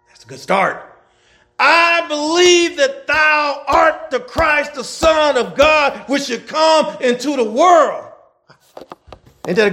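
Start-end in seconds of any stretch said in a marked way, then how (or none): 1.6-8.1 fiery slow scripture proclamation; preaching at full force. Acted. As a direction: proclaim each word slowly to the congregation. Preacher reads Martha's confession.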